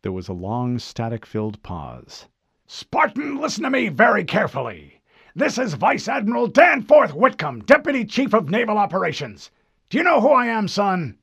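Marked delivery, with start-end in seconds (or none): 2.7-11.2 high, loud, demanding officer voice; angry admiral. Acted. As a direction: bark like an impatient admiral. Admiral asserts rank.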